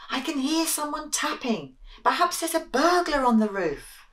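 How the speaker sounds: high, worried little mouse voice; high fretful mouse. Acted.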